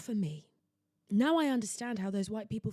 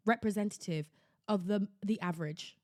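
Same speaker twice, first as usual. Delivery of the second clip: normal talking voice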